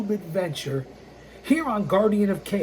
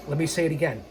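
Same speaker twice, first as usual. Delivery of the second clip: ordinary talk, nothing marked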